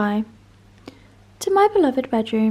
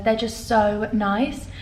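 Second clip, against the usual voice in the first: ordinary talk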